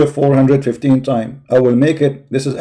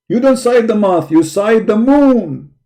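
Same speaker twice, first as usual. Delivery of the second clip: month against moon, "moon" drawn out slowly and hit hard; stress on moon; 6 semitones higher than usual. Real, not acted. Correcting the article's word, he stresses "moon". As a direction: lean on "moon", slowing down. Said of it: "How you can sight the month?".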